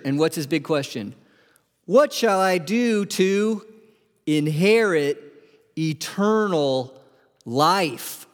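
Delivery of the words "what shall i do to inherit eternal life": slow, word by word; slow emphatic reading. Shown as a demonstration. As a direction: draw out each word of the question.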